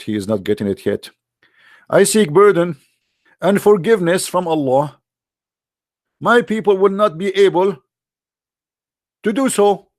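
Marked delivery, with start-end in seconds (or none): 1.9-9.9 higher, insistent plea; mock exasperated refusal; exasperated prophet. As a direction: repeat it with strained patience. Muhammad voiced repeating refusal.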